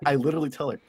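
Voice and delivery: usual speaking voice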